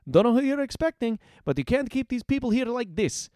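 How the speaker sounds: high, urgent challenge; defiant heroine. A narrator's reading. Heroine confronts captors over the hostages, defiant. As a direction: stand up to them, pitched high.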